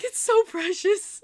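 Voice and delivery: high, loud, drawn-out squeal; gushing. Retold or imitated. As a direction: coo it loudly, utterly charmed. Gushing over the fan's innocent question.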